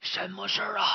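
sleepy mumbled question; half asleep, groggy; drowsy. Acted. Master woken by pig, drowsy and mumbling. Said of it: "迷迷糊糊的问".

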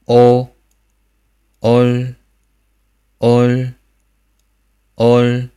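哦 ending with the tongue tip on the palate; drawn-out 哦 closing on the palate; final r coda. Shown as a demonstration. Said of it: "这个音注意一下". (哦加收音)

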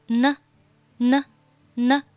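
"呢" as n linked to schwa, "nə"; short isolated "nə" syllables for "呢". Shown as a demonstration. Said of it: "连读成了". (鼻音n和呃连成呢)

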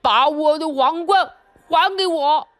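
drawn-out, forceful elephant demand. A narrator's reading. Elephant arguing over the crown with lion and bear, slow and demanding. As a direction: play an elephant insisting, taking your time.

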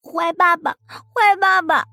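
put-on very high, drawn-out childish whine; slow, high-pitched toddler grumbling in protest; pouting little girl. Small daughter refusing to leave the boy, whiny and pouting. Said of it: "嘴里还嘟囔".

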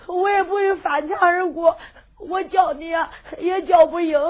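sobbing out the story; crying. Acted. Young disciple tells his master, crying. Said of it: "哭着就说".

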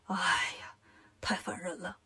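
very low, whispered groan of words; weary exasperation. Acted. Lion demon worn down by shouting outside, whispered exasperation. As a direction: breathe it out, fed up.

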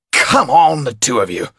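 high, loud rallying call; rally the reluctant pair; impatient showman. A narrator's reading. Topolan urges reluctant pair.

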